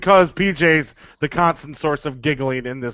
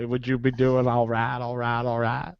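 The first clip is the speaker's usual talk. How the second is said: Matthew McConaughey; 4 semitones lower, 8 dB quieter than usual. Acted. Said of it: "Matthew McConaughey".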